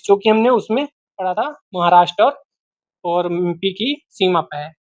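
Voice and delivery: usual speaking voice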